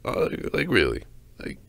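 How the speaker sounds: strained, whispered the fuck; breathless disbelief. Real, not acted. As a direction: gasp it out in disbelief. Exasperated end of rant.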